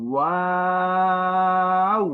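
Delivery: ওয়াও with a rounded w and very long, high, steady aa; rounded lips, not closed. Shown as a demonstration. Teacher shows the correct letter, lips rounded. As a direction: hold ওয়াও evenly, gliding to oo without closing the lips. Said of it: "ঠোঁট গোল করে".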